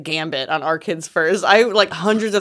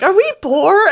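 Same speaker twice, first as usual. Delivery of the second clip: put-on higher, innocent child asking; little girl voice; 13 semitones higher, 3 dB louder than usual. Daughter asking her mom, light higher child voice. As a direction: pitch it up like a small kid.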